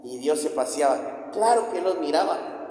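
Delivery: ordinary talk, nothing marked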